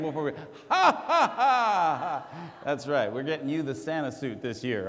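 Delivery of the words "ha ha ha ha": big forced "ha ha ha"; loud, hammed-up stage laugh; demonstrated fake laugh. Shown as a demonstration. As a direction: model an exaggerated laugh for the crowd. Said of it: "try it again".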